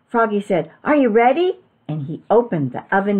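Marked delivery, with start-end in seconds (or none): 0.8-1.6 high, loud rising question; eager build-up. A narrator's reading. Froggy to his parents before opening the oven, eager. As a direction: lift it high for the big reveal.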